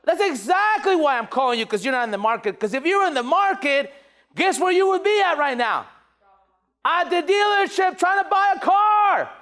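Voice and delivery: energetic pitch building to a high shout; enthusiastic sales pitch. Shown as a demonstration. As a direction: fire it up toward a big finish. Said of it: "With enthusiasm, with energy".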